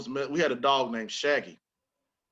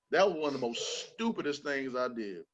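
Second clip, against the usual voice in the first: usual speaking voice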